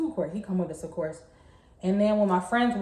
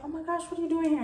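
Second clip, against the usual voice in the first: startled; 9 semitones higher, faster than usual. Retold or imitated. Startled by boyfriend in dorm.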